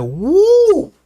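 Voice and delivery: high-pitched whoop of disgust; mock-overwhelmed high woo; reeling "woo". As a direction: let out a long high "woo" at a terrible smell. Reeling from lawyer's bad breath.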